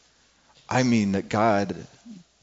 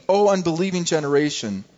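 raised, exasperated rebuke; harsh rebuke; 4 semitones higher than usual. Acted. Jesus rebuking the unbelieving generation, harsh and frustrated. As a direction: scold the faithless crowd with weary frustration. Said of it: "they sound a little bit harsh".

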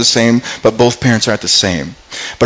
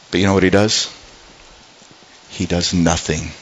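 hushed; 5 semitones lower, 6 dB quieter than usual. Real, not acted. Dramatic reveal of King's inaction.

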